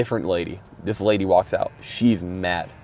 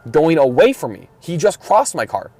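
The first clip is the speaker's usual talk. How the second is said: higher, louder, arguing his case; indignant protest; 7 semitones higher, 4 dB louder than usual. Retold or imitated. Protesting to the driving examiner, indignant and raised. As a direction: insist the man was already gone. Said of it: "I'm like".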